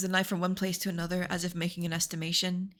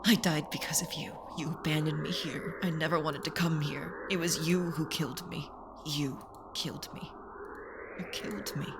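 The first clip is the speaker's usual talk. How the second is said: lower, cold accusation fading softer; accusing shadow voice; 3 semitones lower, 4 dB quieter than usual. Acted. Shadow posing as Nageki accuses Hitori, low fading voice. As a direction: blame him, dying away at the end.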